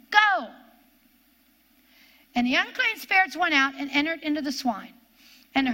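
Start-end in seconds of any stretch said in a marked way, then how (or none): 0.1-0.5 loud, high single-word order; sharp command. Shown as a demonstration. Preacher demonstrating a command to devils, loud. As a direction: snap the order at the spirits. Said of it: "Giving them a command".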